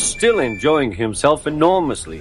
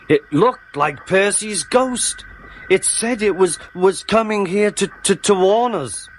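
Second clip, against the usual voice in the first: high, halting, frightened stammer; acted scared, high-pitched voice; feigned fright; 9 semitones higher than usual. A narrator's reading. Toby feigns fright of ghost.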